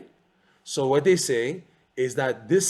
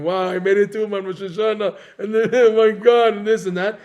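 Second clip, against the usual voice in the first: laughing, higher, louder elation; laughing, giddy pilgrim on a high; 7 semitones higher, 9 dB louder than usual. Acted. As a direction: gush like a pilgrim who made it to Uman. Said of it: "they're flying".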